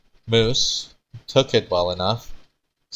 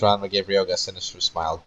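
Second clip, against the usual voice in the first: usual speaking voice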